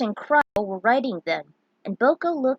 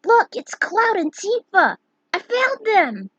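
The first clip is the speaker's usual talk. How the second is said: put-on high, loud child's shout; excited little girl; 12 semitones higher, 7 dB louder than usual. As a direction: yell like a thrilled child.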